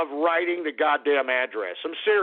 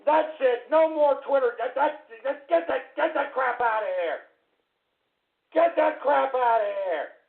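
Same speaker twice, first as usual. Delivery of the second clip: angry outburst; 6 semitones higher than usual. Real, not acted. Streamer rants at chat.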